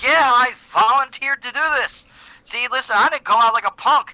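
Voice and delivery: high, loud bragging put-on voice; hammed-up high boast; boastful mock voice. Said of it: "He was like".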